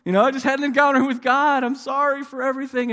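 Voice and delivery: high, loud wailing son; hammed-up bawling. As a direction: blubber an apology to mom, comically overdone. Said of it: "She just starts bawling".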